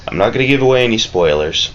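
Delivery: ordinary talk, nothing marked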